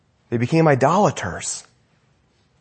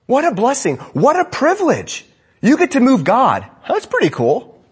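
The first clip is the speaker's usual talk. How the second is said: high, lifted wonder; excited marvel; 8 semitones higher than usual. Real, not acted. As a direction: marvel at the gift with rising awe. Preacher marvels at privilege.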